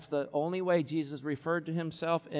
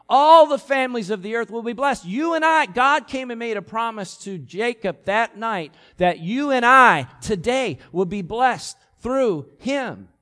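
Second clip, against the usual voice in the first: much higher and louder, slowing on the promise; preaching climax; 7 semitones higher, 9 dB louder than usual. Real, not acted. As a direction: lift it to the peak of the sermon. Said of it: "sermon climax".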